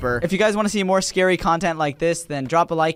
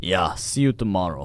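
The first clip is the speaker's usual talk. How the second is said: low, sleepy reply; groggy worker; 10 semitones lower, 3 dB quieter than usual. Acted. Worker saying goodbye to coworker, low and groggy. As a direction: drop low for a tired goodbye. Voices groggy player character.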